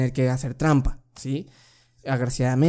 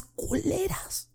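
hushed swear word; 13 semitones higher, 9 dB quieter, much slower than usual. Real, not acted. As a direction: drop to a soft, slow hush on the swear. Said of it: "Lo digo en voz bajita". (palabrota dicha en voz bajita)

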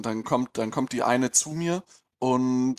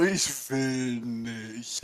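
put-on dazed girl; 3 dB quieter, much slower than usual. Retelling the drugged girl.

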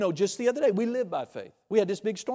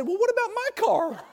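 mock whiny husband. Husband's mock protest to wife.

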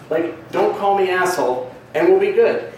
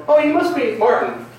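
high, bright-eyed "oh!" of recognition; excited greeting; 9 semitones higher than usual. Retold or imitated. Comedian greeting a dude by name, high and eager. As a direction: greet a guy you know of, pitched way up. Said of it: "I go up to him and go".

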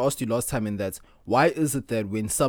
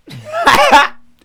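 squealing burst of laughter; high laugh; 12 dB louder than usual. Real, not acted. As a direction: shriek with laughter. Laughing at the story.

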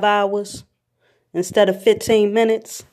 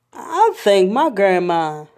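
ordinary delivery

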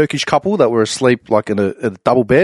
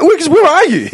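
high, louder disbelieving shout; shocked dad; 4 dB louder than usual. Retold or imitated. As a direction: squawk it like a stunned father.

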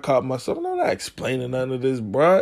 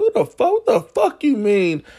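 outraged; 8 semitones higher than usual. Retold or imitated. Retold outrage at her text.

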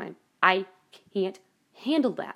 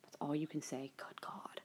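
whispered, lower and fed up; exasperated whisper; 6 semitones lower, 16 dB quieter than usual. Retold or imitated. Annoyed at mom's bland reply, whispered exasperation. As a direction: mutter it under your breath. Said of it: "I'm like".

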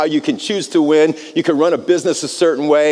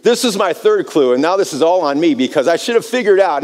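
usual speaking voice

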